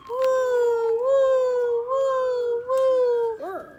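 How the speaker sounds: high, loud wind howl; howling wind noise. Pretend. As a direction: whoo like a snowstorm wind. Blowing snowstorm wind.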